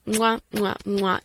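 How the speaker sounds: kissing noises into the mic; blown kisses. Real, not acted. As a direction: smack a few kisses goodbye.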